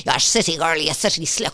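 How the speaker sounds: mock angry Cork caller; hammed-up furious rant; mad locals. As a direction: imitate an irate local's tirade of insults. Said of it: "People are really mad. They were like".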